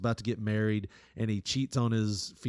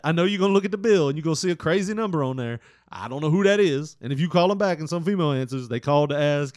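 higher, louder, fast rushed excuse; mock panicked boyfriend, fast and loud; fast frantic excuses; 5 semitones higher, 7 dB louder, faster than usual. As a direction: rattle the alibi off fast. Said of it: "he said that".

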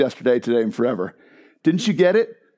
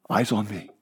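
softer, lower, intimate command; 7 semitones lower, 7 dB quieter than usual. Acted. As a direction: draw them in gently. Voicing Jesus' command.

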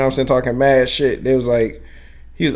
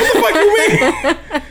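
high, slow, laughing what-the-hell; laughing disbelief; much slower than usual. Retold or imitated. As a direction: drag it out high through a laugh. Incredulous quote with laugh.

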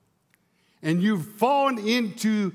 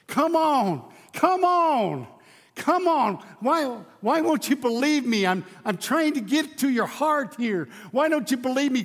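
begging Jesus; 7 semitones higher than usual. Acted. Jesus begging the people to believe him, pleading. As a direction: beg them to believe, voice lifting. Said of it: "Jesus is begging these people".

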